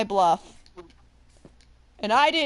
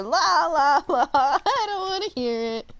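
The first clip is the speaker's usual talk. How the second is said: high, laughing sing-song la la; childish la la la; 5 semitones higher than usual. Retold or imitated. Teacher drowning out the student's reading, laughing childish la la. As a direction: drown him out like a kid. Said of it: "like freaking middle school".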